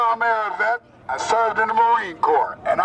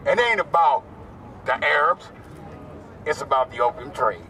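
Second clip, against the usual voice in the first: normal talking voice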